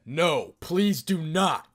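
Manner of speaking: loud, slow desperate no; loud plea. Acted. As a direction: beg her loudly, slowly. Drake begs her not to tell.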